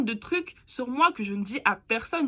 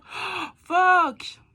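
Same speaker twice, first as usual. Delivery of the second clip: loud, high burst of exasperation; exasperated outburst; 9 semitones higher, 8 dB louder than usual. Real, not acted. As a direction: blurt it out, fed up with your own rambling. Exasperated at own rambling.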